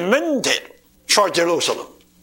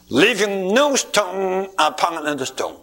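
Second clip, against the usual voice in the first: usual speaking voice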